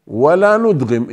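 ordinary talk, nothing marked

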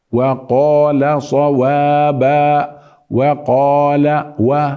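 وقال slow, و kept thin; واو مرققة; light waw. Shown as a demonstration. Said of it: "وليس و".

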